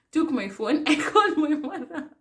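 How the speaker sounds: telling it through real laughter; laughing. Real, not acted. Retelling the fall, still laughing helplessly. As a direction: let the giggles break into the words. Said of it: "I couldn't stop laughing".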